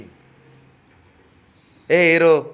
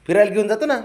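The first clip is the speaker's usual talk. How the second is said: laughing promise; 10 semitones higher, 4 dB louder, faster than usual. Real, not acted.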